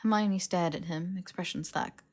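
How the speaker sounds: usual speaking voice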